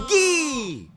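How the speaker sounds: high, loud mock baby yell of gee; imitating baby shout. Weatherman imitates his son's shout, high and loud. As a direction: squeal it like a toddler. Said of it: "And he would just shout".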